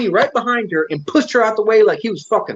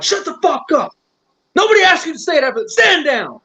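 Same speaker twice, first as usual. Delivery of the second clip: mock angry outburst; 9 semitones higher than usual. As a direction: imitate someone snapping orders in a high voice. Acting out someone silencing the sergeant.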